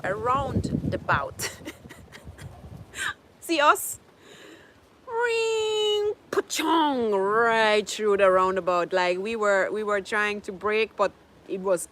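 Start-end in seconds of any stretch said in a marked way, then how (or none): 5.1-7.1 crash sound effect. Retold or imitated. Imitates car crash noise.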